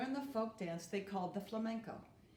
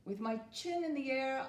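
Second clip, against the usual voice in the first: plain reading voice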